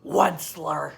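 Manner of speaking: a mild croak on the name; croaking cry. Acted. Lorax croaks the Onceler's name, mild cry. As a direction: call out the name with a light croak. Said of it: "he cried with a crefulous croak".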